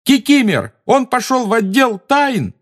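yelling. Acted. Harry keeps yelling.

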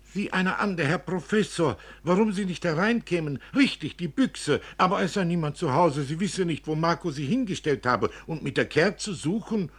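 höher und lauter, plappernde Nachbarin; geschwätzige alte Frau. Acted. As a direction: den Herrn Professor überschwänglich begrüßen. Filuccia begrüßt den Professor. (redselige Alte, überrascht)

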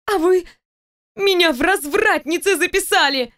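high-pitched offended outburst; fury and hurt. A narrator's reading. Insulted employee lashing out at boss, furious and hurt. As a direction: pitch it high throughout, outraged and wounded. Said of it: "В пылу ярости и обиды".

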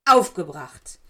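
angry shout. A narrator's reading. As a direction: blast it out in fury. Severus shouts final word. (wütend herausgeschrien)

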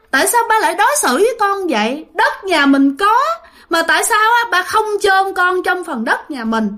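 high, pleading, accusing son; reproachful ghost child. Retold or imitated. Dead son's spirit reproaching his father, high pleading. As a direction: beg and blame at once, voice lifted high. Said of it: "trách móc rất là nhiều".

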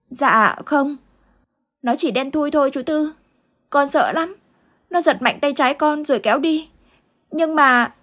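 put-on a small boy still shaken by the nightmare; frightened little boy. Boy answering Uncle Tư about the dark figures, frightened child voice. Boy's voice held.

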